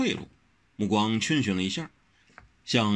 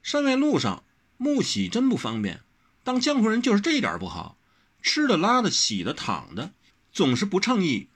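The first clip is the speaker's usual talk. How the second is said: lightly voiced girl, higher, grumbling; Wen Rou's voice; 11 semitones higher than usual. Girl complaining about life on the road, higher and petulant.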